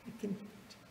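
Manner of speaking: whisper. Retold or imitated. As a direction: breathe it to her in a whisper. Swami reassures the old lady.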